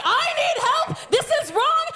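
high-pitched mock panic; retold screaming for help. Taped-up student calling for help against her leaders, mock frantic. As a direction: plead loudly, hammed-up. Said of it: "and I'm screaming".

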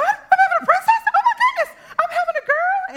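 very high, loud, laughing squeal of joy; ecstatic squeal. Retold or imitated. Mother hearing it's a girl, high loud joyful squeal. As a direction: shriek it out in giddy delight.

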